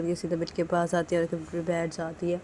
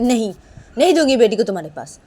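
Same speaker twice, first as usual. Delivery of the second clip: loud, defiant, raised-pitch no; angry refusal; 5 semitones higher, 12 dB louder than usual. Acted. Manisha refusing Nisha the baby, loudly and angrily. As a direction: refuse point-blank in a big voice.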